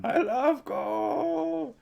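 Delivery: high, very slow villain croon; hammy movie villain impression, drawn right out; film villain catchphrase. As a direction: stretch each word in a silly high voice.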